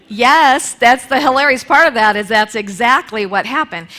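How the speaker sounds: delighted loud telling; excited laugh-through. Real, not acted. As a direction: burst out, amused at how it turned out. Laughing at the ironic outcome.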